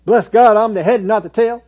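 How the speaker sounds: loud, high rote declaration; rattled-off confession. Acted. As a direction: belt it out like an automatic slogan. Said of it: "just rattle them off".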